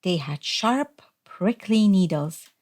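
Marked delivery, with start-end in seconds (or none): none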